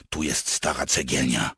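ordinary delivery, nothing marked

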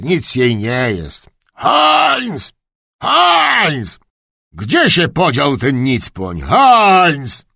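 loud, high, drawn-out calls for a servant; irritable old duke. Acted. Duke shouting for his missing kennelman, irritable. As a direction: bellow the name with a falling pitch.

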